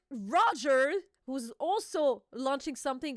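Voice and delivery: ordinary talk, nothing marked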